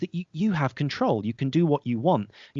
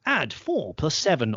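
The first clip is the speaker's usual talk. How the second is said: slow, higher quiz prompt voice; brain-training host; 4 semitones higher, slower than usual. Acted. Voice of a brain training podcast setting a sum, measured host delivery. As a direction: read out the sum slowly, pitched up. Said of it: "I was just the voice of that".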